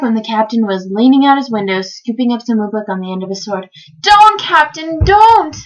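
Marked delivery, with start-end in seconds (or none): 4.0-5.6 high, loud, drawn-out cry; desperate plea. A narrator's reading. Bartholomew trying to stop the captain eating, desperate plea. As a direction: beg him urgently to stop.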